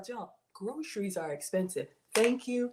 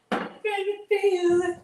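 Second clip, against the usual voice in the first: much higher, louder cry; building cry; 12 semitones higher, 5 dB louder than usual. Pretend. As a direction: peak of the build. Building peak.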